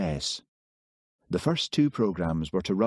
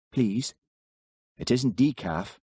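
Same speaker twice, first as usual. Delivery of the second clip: put-on light, higher lilting young woman; a gently voiced Highland girl; soft lilting Highland woman's voice; 6 semitones higher than usual. A narrator's reading. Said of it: "Her soft voice had a Highland lilt".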